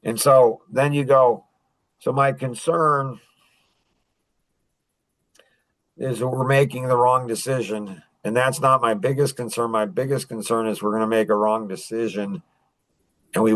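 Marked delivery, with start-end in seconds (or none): 2.0-8.0 unhurried, measured phrasing; slow delivery. Shown as a demonstration. Salesman talking slowly to an analytic customer, measured. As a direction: take your time on each word. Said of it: "slow down and say".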